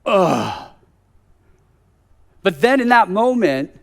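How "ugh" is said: frustrated groan. Retold or imitated. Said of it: "And I'm like".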